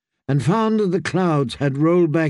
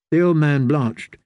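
plain reading voice

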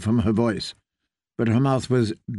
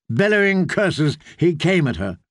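usual speaking voice

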